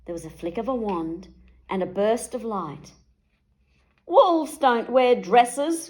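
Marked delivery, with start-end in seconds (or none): 4.1-5.9 slow, loud protest; wolf protesting his new dress; cried out. A narrator's reading. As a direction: wail it, dragging each word. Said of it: "cried the wolf".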